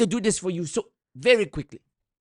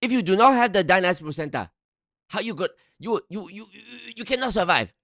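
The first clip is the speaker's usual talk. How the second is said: higher, stuttering Nigerian pitchman; mock Nigerian accent; 5 semitones higher than usual. Mocking the scam sales pitch, Nigerian accent. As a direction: warn them they cannot live without it. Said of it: "in the Nigerian voice the whole time".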